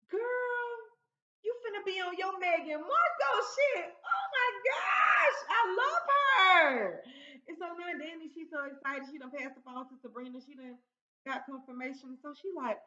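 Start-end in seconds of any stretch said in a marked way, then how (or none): 0.1-7.0 high, loud squeal building to a drawn-out shout; hammed-up ecstatic outburst; thrilled shrieking. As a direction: act out an over-the-top gush, shouting higher and longer as it goes. Acts out Danny's thrilled discovery.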